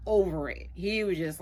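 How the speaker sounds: ordinary talk, nothing marked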